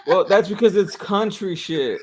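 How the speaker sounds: amused laughing retort. Real, not acted. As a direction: chuckle through the point. Laughing retort about country.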